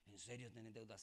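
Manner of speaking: soft, low imitation of a friend; hushed, low-pitched query; quiet retold question. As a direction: ask it discreetly, as in a retold chat. Retold private chat about debts.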